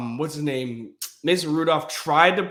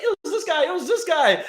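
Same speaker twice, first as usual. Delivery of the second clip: much higher, giggly snitching voice; ratting him out; 12 semitones higher than usual. Retold or imitated. Fans snitching on the heckler to Westbrook, high giggly voice. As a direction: point him out fast, half laughing. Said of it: "people around him be like".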